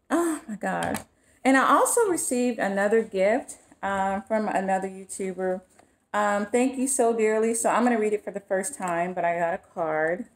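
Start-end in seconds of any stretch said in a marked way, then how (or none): none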